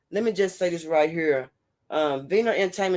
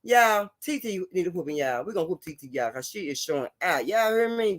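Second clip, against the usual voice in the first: normal talking voice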